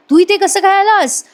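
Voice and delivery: high-pitched mock surprise; retold startled greeting to an unexpected visitor; surprised question. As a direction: pitch jumps up, acting out astonishment.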